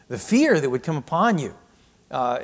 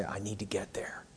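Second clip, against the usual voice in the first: near-whisper, much softer and lower; whispered longing of the prophet; hushed inner plea; 5 semitones lower, 16 dB quieter than usual. Retold or imitated. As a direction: drop to a whisper for his private thought.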